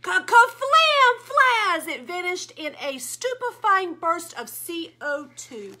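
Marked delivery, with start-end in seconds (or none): 0.0-1.9 loud, higher, slow boom word; big boom; explosion sound. Acted. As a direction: blast it out like an explosion. Explosion sound read aloud.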